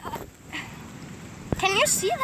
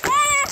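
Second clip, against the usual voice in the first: short yell; 6 semitones higher, 3 dB louder than usual. Real, not acted. Yelling while sledding.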